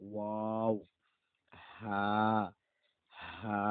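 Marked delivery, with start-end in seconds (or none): none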